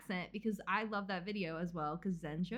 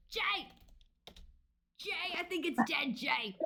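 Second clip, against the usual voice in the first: put-on Boston accent; 7 semitones higher, slower than usual. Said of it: "the Boston sunfish guy accent".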